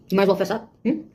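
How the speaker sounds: normal talking voice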